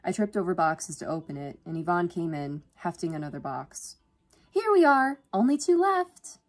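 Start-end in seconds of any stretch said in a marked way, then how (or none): 4.5-6.4 high, loud, chirpy announcement; endlessly cheerful. A narrator's reading. Yvonne arriving with another box, chirpy. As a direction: announce it brightly, pitched way up.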